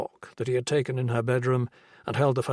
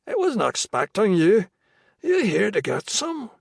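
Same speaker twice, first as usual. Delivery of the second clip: higher, louder Irish brogue; a high dealer in thick Irish; Irish brogue, high and loose; 9 semitones higher, 5 dB louder than usual. A narrator's reading. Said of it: "in a thick brogue".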